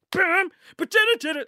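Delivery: loud, high mouthed TV theme sting; imitated theme music. Podcaster acting out the cliffhanger theme, mouthed theme sting. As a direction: belt out the theme like a drum hit.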